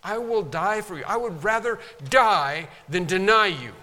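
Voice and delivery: high, loud insistent pledge; emphatic vow. Retold or imitated. Peter repeating his vow to Jesus, loud and insistent. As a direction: hammer the promise home. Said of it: "That's very emphatic".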